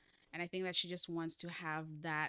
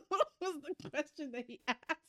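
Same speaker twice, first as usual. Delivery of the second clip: high, giggly question; laughing; 8 semitones higher than usual. Real, not acted. Host losing her place in the story, laughing. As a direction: ask it through real laughter. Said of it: "Why are you laughing".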